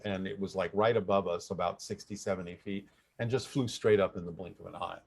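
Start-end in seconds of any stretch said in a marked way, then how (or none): none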